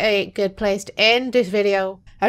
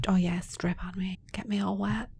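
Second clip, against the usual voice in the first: joking breathy whisper; hammed-up seductive whisper; mock sultry whisper; 3 semitones lower, 8 dB quieter than usual. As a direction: tease in a whisper. Mock sexy reply to plant.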